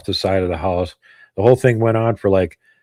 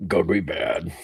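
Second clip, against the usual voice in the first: mock creature voice; 2 semitones lower, 8 dB quieter, slower than usual. Joins in imitating the creature.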